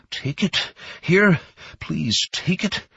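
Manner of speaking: breathy, high desperate begging; gasped pleading. Acted. Beaten Ma begging officers to take the watch, gasping. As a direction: beg them through the pain. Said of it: "Ma gasps".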